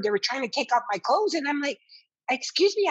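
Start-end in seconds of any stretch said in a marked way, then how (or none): 2.3-2.9 sudden high indignant "excuse me"; startled protest. Retold or imitated. Patient objecting to staff removing her clothes, startled. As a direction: pull back, shocked, asserting yourself.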